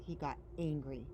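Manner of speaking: ordinary delivery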